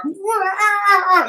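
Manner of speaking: high "wah" like frightened passengers; mock passenger wail. Mimicking people on the ship, mock wail. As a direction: imitate a crowd's cry. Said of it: "like this".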